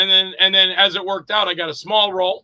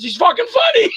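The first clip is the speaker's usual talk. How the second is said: laughing outburst; 3 dB louder than usual. Retold or imitated. Director laughing in back seat.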